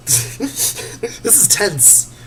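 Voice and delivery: laughing through a tense moment; nervous laugh. Real, not acted. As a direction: giggle out the words slowly. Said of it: "Getting a little shaky here".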